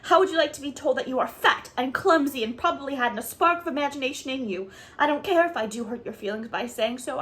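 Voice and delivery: put-on child raging at the woman who insulted her, shouting; furious tirade. A narrator's reading. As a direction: pour out the hurt and fury. Anne's furious tirade continues.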